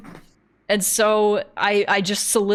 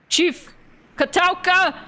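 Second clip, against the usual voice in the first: high-pitched call to the boss; 10 semitones higher, 3 dB louder than usual. Acted. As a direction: call for the chief's attention. Acted role calls out to chief.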